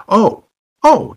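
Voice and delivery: high, loud "oh" of sudden realization; taken aback. Retold or imitated. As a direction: let the surprise jump up in pitch. Retold reaction to deaf dwarf.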